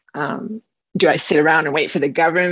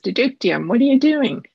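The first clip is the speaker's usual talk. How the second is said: exasperated question, leaping higher; fed up; 7 semitones higher, 3 dB louder than usual. Acted. Bear confronts the hummingbird in the story, fed up. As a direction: act out a bear who has had enough. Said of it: "Bear has enough".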